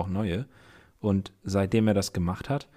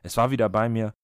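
story narration; 4 semitones lower than usual. A narrator's reading. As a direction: read it like a radio drama narrator. Erzählt Hörspielgeschichte weiter. (wie ein Hörspielerzähler vorgelesen)

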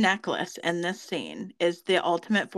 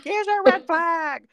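mock announcer; 10 semitones higher, 4 dB louder, slower than usual. Imitating field announcement.

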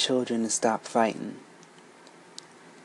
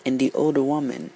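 usual speaking voice

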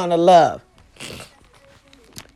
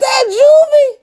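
excited; 5 dB louder, slower than usual. Real, not acted. Excited recognition of old group.

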